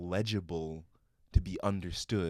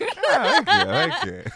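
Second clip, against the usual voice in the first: laughing; 10 dB louder than usual. Real, not acted. Laughing agreement, much higher.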